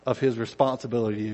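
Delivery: ordinary talk, nothing marked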